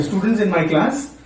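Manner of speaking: ordinary talk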